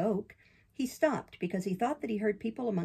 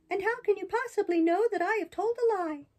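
high, bright-eyed puppet, much higher than the narrator; puppet boy voice; 12 semitones higher, 4 dB louder than usual. Acted. Pinocchio protests to the fairy in a high boyish voice. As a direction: play Pinocchio as an eager young boy defending himself.

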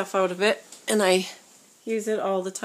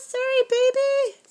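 put-on baby talk; 7 dB louder than usual. Comforting the doll.

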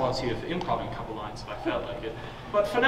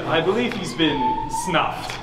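ordinary talk, nothing marked

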